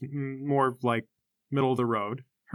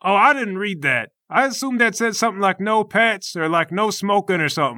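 higher, slightly louder character voice; Obliterator, easygoing fighter; 7 semitones higher, 5 dB louder than usual. Acted. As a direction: play a guileless fighter, pitched up.

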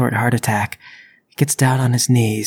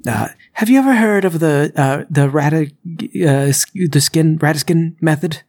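halting, stammering excuse, pitched up; flustered burglar; 3 semitones higher than usual. Acted. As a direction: fumble for words as an embarrassed liar. Narrator plays flustered burglar making excuses.

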